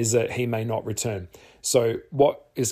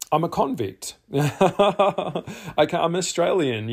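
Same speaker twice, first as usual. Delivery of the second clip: laughing; 7 semitones higher, 4 dB louder, slower than usual. Real, not acted. Jokes about convict ancestry.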